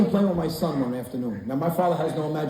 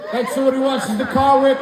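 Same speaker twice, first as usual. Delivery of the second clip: laughing shout; 9 semitones higher, 5 dB louder than usual. Real, not acted.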